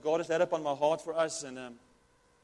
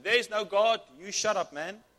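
mocking; 3 semitones higher, 4 dB louder than usual. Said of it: "The atheist comes and he mocks her".

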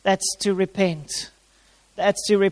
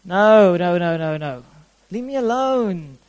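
stubborn refusal; 2 semitones lower than usual. Retold or imitated. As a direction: push the gentle knock away like a sulking child. Resisting the Spirit's knock.

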